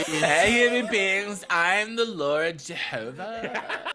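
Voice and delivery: slow, laid-back guy playing God; casual God. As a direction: greet mankind like a buddy. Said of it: "funny if, like, it's still [name]'s voice".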